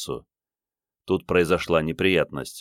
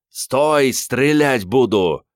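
loud, raised command to halt; shouted police warning; 6 semitones higher, 5 dB louder, much slower than usual. A narrator's reading. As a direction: call out a drawn-out final warning. Officer shouts warning at fleeing man.

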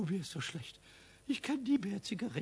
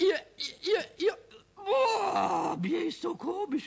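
queasy, higher groaning voice; sick and dizzy; 7 semitones higher, 5 dB louder than usual. Acted. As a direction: moan like your stomach turns. Pumuckl sick from smoking.

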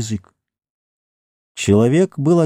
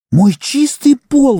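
high, slow, indignant wail; outraged, dragging each word; indignant cry; 4 dB louder, much slower than usual. A narrator's reading. Housewife wails at the muddy intruder, indignant. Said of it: "возмутилась она".

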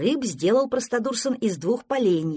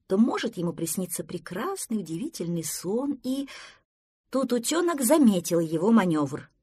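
plain reading voice

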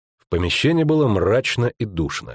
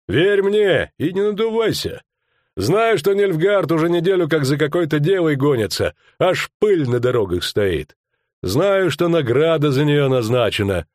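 dismissive rustic thug; 7 semitones higher than usual. Acted. Verk dismissing Skomlik's boasts, scornful rustic voice. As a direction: brush him off, sure of yourself.

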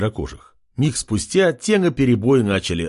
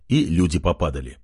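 plain reading voice

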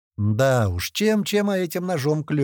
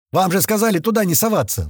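higher, louder reproach; reproachful shout; 8 semitones higher, 5 dB louder than usual. A narrator's reading. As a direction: shout it at the careless men. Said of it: "выкрикнул один из шахтёров". (возмущённый выкрик, выше и громче)

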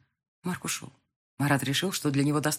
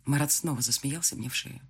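plain reading voice

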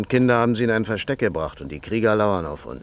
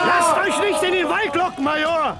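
warning shout. Acted. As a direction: yell a warning at the major across the fight. Scout shouts warning in battle.

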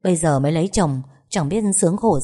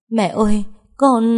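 choked sob; 4 semitones higher, 4 dB louder than usual. Acted. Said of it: "nghe thấy con gái khóc nghẹn".

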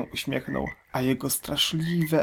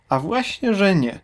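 squeaky high objection, louder; the smaller crocodile bristles back; high indignant protest; 8 semitones higher, 5 dB louder than usual. Acted. Small crocodile insisting to the big one, high and indignant. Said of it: "sprzeciwił się nie tak wielki".

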